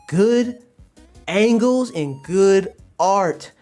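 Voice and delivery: GOOD ANGLES drawn out slowly, pitched high; emphatic delivery. Real, not acted. Streamer telling chat his criteria, slow and emphatic. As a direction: stretch "good angles and good art", pausing between.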